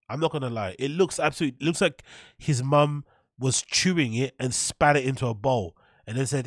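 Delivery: normal talking voice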